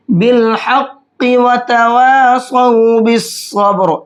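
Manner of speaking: slow chanted recitation, हक़्क़ि read with its kasra; recitation without stopping. Shown as a demonstration. Teacher shows the reading without a verse stop, slow and chanted. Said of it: "इस तरह से पढ़ेंगे".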